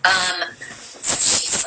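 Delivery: usual speaking voice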